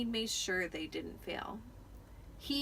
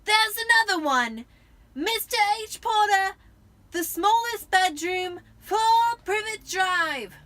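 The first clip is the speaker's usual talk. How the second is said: put-on high, loud child's shout; yelling the news down the hall; 10 semitones higher, 11 dB louder than usual. A narrator's reading. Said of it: "Then he shouted".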